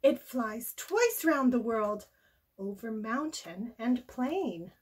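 ordinary delivery, nothing marked

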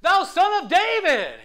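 very loud, high, slow cry. Retold or imitated. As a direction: shout it over the crowd, drawn out. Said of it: "He called out even greater".

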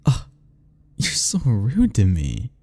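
ordinary delivery

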